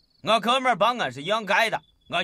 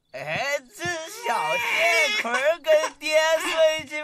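put-on slow, high bellow at the child; drunken shout; 8 semitones higher than usual. Drunk father hollering for the child to come sleep with him, drunken shout. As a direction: roar it drunk and pleased with himself.

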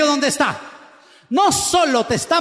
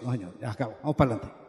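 much softer and lower, gently firm; wife ending the crying, quiet and firm; 14 semitones lower, 12 dB quieter, much faster than usual. Retold or imitated. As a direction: wrap it up kindly but decisively.

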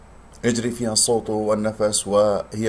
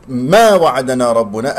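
ما وعدنا ربنا with ما lifted and louder; stressed ما; 6 dB louder, slower than usual. Shown as a demonstration. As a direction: hit ما hard, making it sound negative. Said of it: "أنت رفعت صوت الميم". (رفع الصوت بنبر ما كأنها نافية)

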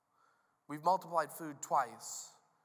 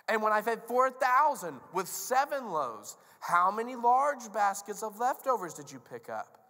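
loud, high interrogation; stern, raised-voice rebuke; exasperated Jesus; 6 semitones higher, 8 dB louder than usual. Acted. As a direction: press the question on failing students. Jesus keeps grilling the disciples.

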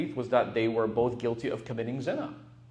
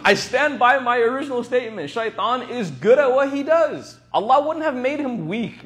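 much higher and louder, driving the point home; emphatic insistence; 9 semitones higher, 8 dB louder than usual. Real, not acted. Preacher to his audience, insisting loudly and excitedly. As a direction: stand firm and proclaim it. Defending his original khutbah statement.

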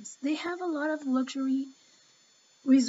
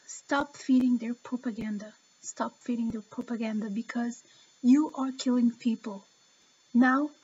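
ordinary delivery

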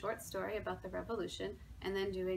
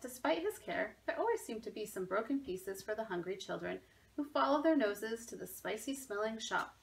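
ordinary delivery, nothing marked

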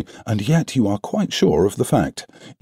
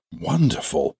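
surprised exclamation; 6 semitones higher, 3 dB quieter than usual. A narrator's reading. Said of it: "I ejaculated".

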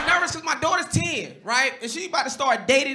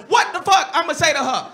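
ordinary delivery

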